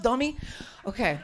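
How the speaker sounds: normal talking voice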